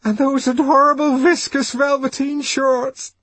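high-pitched, loud, overwrought woman; tearful gushing. A narrator's reading. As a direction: gush in a torrent, close to tears. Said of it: "The torrent of words flowed sobbingly".

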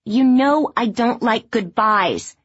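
high, slow narrated shout; a moderate shout in character, drawn out. A narrator's reading. Daughter protests her mother's goodbye, shouting. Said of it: "Eliza shouts".

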